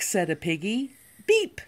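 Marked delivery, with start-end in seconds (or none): 1.3-1.6 high squeaky beep; horn beep. Acted. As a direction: chirp it like a toy horn. Truck horn in a picture book.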